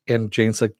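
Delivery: ordinary talk, nothing marked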